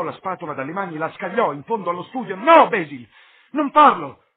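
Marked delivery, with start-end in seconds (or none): 2.3-4.2 much louder and higher, a cry to stop him; frantic yell of no; desperate shout. A narrator's reading. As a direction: scream to save the painting. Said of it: "gridò".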